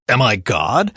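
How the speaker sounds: plain reading voice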